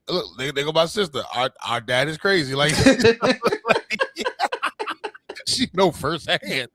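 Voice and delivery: ordinary delivery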